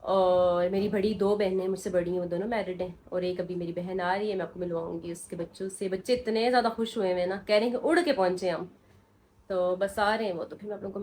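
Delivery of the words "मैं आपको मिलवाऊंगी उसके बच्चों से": ordinary talk, nothing marked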